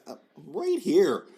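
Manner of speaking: high, drawn-out mock plea; mock protest. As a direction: stretch it out like an overlooked husband. Husband mock-protests being overlooked by wife.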